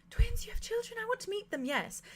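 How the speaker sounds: high, eager gasp of delight; excited Arthur. Acted. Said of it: "And Arthur's just like".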